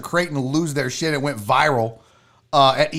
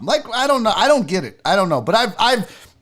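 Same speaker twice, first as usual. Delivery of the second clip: normal talking voice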